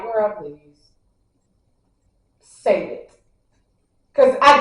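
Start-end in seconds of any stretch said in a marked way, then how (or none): 0.0-3.1 unimpressed drawl. Retold or imitated. Looking at her unimpressed.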